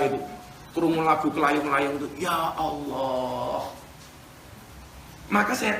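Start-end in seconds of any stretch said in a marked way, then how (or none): 2.2-3.7 long-drawn, soft "ya Allah"; plaintive sigh. Acted. Preacher hearing a mournful song, plaintive sigh. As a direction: draw it out slowly and softly.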